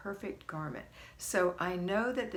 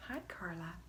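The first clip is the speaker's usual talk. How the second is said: whispered greeting; 9 dB quieter than usual. Real, not acted. Greeting viewer in soft aside.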